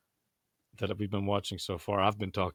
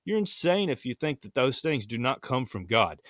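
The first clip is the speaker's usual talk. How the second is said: usual speaking voice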